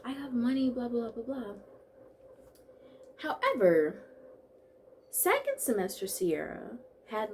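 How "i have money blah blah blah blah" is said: mock happy past self. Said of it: "I'm like".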